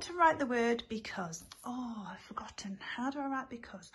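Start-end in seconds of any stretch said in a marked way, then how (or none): 1.6-3.9 mock worried whisper to herself; hushed play-acted puzzlement; pretend forgetful whisper. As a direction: whisper as if stuck on the word. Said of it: "pretends to forget the spelling".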